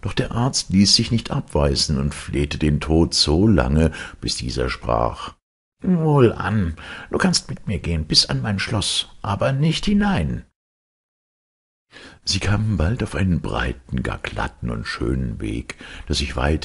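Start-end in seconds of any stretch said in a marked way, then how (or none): 5.8-10.4 kindly Death, voiced much higher; Death's voice. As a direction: speak as gentle Death, pitched up. Death answers the doctor.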